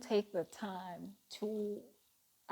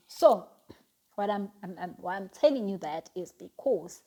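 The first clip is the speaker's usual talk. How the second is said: usual speaking voice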